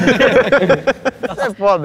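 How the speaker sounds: drawn out. Real, not acted. Drawn-out exclamation.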